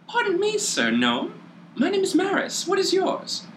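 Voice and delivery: very high, dainty lady's voice; sweet, airy and far up in pitch; high Aasimar voice. Acted. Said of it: "She just says".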